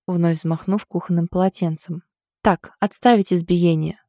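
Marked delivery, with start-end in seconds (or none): none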